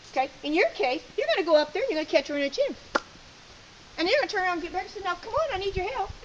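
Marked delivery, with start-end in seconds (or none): 5.0-6.1 urging appeal to dog. Acted. Handler urging the dog to help.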